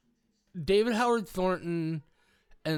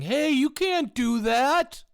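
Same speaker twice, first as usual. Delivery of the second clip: high, louder, slow and drawn-out scolding; mock whiny protest; 10 semitones higher, 5 dB louder, slower than usual. Mocking people who only protest, slow high whine. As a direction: whine it like a helpless crowd. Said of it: "giant chorus of people saying".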